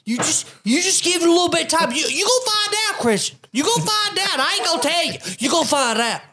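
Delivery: high mocking voice, Pete's taunt. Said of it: "All he would say was".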